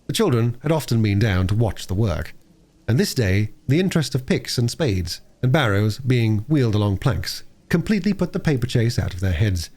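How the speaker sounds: usual speaking voice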